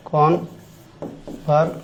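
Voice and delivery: কনভারসেট said very slowly and softly as the wrong form; teacher showing students the wrong word কনভারসেট, slow and soft; mock wrong form. Shown as a demonstration. শিক্ষক ভুল শব্দ কনভারসেট ধীরে নরমভাবে দেখাচ্ছেন. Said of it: "না".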